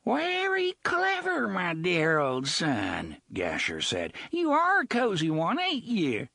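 leering pirate mocking the gunslinger; Gasher the pirate. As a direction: sneer it out with oily delight.

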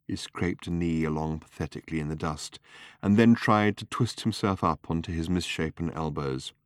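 plain reading voice

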